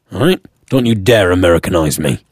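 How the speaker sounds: ordinary delivery, nothing marked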